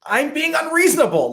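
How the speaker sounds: high, loud mock outburst; angry acknowledgement. Husband admitting fault to his wife mid-argument, angry acknowledgement. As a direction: blurt it out in exaggerated exasperation. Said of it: "it's just this angry acknowledgement".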